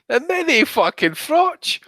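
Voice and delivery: mini Froch squeaked up high and slow; mocking high repeat. Mocking a rival's nickname, high sneering squeal.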